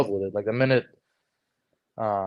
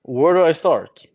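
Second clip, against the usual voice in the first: eager reply; 7 semitones higher, 7 dB louder than usual. Retold or imitated. Young man answering his brother-in-law, eager and raised. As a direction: replay your younger self jumping at the chance.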